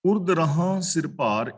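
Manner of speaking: slow, measured verse reading; drawn-out sacred verse; scripture recitation. Real, not acted. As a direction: recite the line slowly, word by word.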